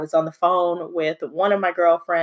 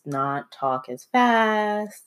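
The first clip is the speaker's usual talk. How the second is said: slowed country drawl; 3 semitones higher, 3 dB quieter, much slower than usual. Shown as a demonstration. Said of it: "add a little twang".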